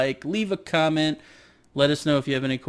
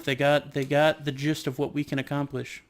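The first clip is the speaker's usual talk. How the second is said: usual speaking voice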